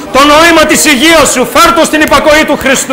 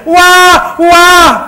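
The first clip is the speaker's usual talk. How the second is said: playful newborn wah wah; mock baby wail; 3 semitones higher than usual. Preacher imitating a newborn's cry for the congregation, mock wail. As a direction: imitate a crying newborn, lightly. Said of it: "το τόξο σου φώναζε".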